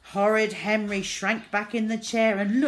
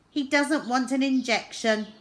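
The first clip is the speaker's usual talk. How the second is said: nurse voice; 7 semitones higher, 5 dB louder than usual. A narrator's reading. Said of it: "said Nurse Needle".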